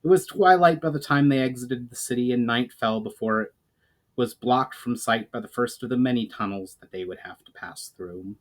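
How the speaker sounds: plain reading voice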